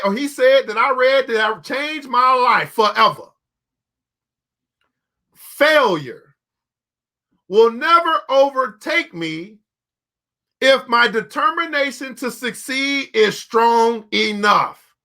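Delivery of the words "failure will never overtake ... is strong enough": slow, deliberate dictation so they can write. Shown as a demonstration. Preacher dictating his motto to the congregation, slow and deliberate. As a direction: spell it out slowly like a creed. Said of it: "Write this down".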